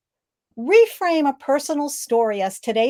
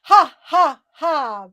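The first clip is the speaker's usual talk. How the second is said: loud, higher ha ha ha; lung laugh; 4 semitones higher, 5 dB louder, much slower than usual. Shown as a demonstration. Coach leading the group in a ha ha ha laugh. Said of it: "Breathe in".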